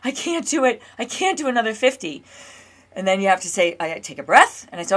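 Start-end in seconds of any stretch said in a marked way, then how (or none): 0.0-2.2 higher, alarmed cry of the nervous system, replayed; a frightened inner voice losing heart, pitched up; panicked inner voice. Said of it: "my entire nervous system reacts to that and says".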